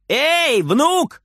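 very high calling-out; grandma's high, carrying call; shouting from kitchen. A narrator's reading. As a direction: call across to another room, high. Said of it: "кричит бабушка из кухни".

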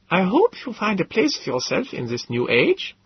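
put-on high, earnest accented professor; mean it kindly, in his odd voice; sincere Gödel. A narrator's reading. Gödel sincerely wishes the narrator well, high and accented. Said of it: "said to me sincerely".

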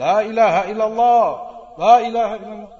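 loud, high, urgent yell of la ilaha illallah; the wrong way, yelling at the dying; shouting. Shown as a demonstration. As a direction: bellow it over the bed. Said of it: "sambil teriak-teriak".

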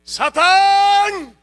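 loud, high challenge hurled at Satan; defiant shout. Retold or imitated. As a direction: bark the challenge at the devil. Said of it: "y decía".

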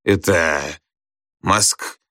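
hesitant, broken-off answer; Gradusov faltering. A narrator's reading. Pupil fumbles the teacher's question, faltering. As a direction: grope for it and stop mid-word. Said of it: "опять сбился Градусов".

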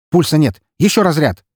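громко и высоко; кричи срочно; тревожный выкрик. Acted. Реанимация.

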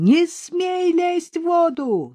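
very high, drawn-out warning cry; strained, stretched-out holler at a dog; anguished yell. A narrator's reading. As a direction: forbid it in a desperate high wail. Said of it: "надрывным голосом завопил её хозяин".

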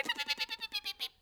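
very high chirpy peeps; bird peeping. Acted. Mimics a bird's peep.

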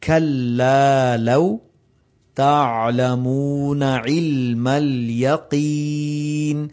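slow chanted tilawat; melodic recitation. Acted. Teacher reciting the verse for his students.

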